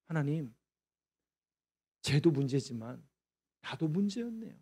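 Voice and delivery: soft, hushed confessing prayer; humble confession. Acted. David quietly confessing his sin to God, hushed.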